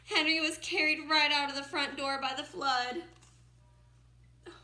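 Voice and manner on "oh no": usual speaking voice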